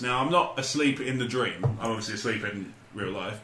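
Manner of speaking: ordinary talk, nothing marked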